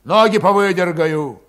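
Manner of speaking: loud, ill-natured threat; menacing promise. A narrator's reading. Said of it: "недобрым голосом пообещал".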